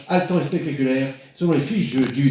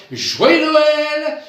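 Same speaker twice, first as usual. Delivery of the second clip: festive sign-off; 7 semitones higher, 4 dB louder, much slower than usual. Real, not acted.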